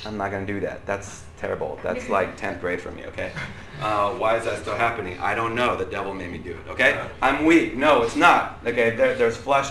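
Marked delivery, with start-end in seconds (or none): 0.1-3.3 low, soft aside to self; subdued self-restraint. Retold or imitated. Speaker telling himself not to do that, subdued. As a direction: talk yourself down quietly.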